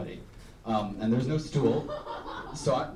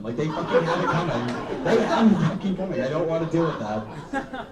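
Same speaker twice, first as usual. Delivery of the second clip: higher and louder laughing rant; 5 semitones higher, 4 dB louder than usual. Real, not acted. Ranting to the crowd about spiders, gleeful laughing fury.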